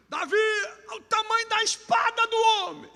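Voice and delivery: high shouted taunt across the field; mock shout. Eliabe shouting at Davi from the ranks, high mock shout. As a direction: yell it like a mocking older brother. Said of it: "Parece que eu vejo Eliabe gritando".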